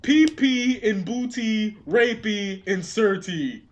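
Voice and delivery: slow silly. Retold or imitated. Repeating the sketch line.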